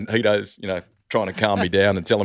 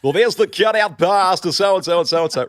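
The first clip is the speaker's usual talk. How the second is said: high, loud, fast basketball commentator call; Ray Warren; 10 semitones higher, 7 dB louder than usual. Retold or imitated. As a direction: launch into a booming courtside play-by-play. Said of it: "So I break into Ray Warren".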